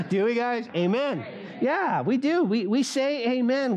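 rally the room for an amen; playful call-out. Real, not acted. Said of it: "We don't really say amen, do we, guys?".

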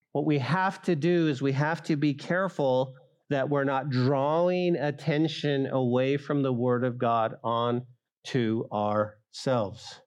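ordinary delivery

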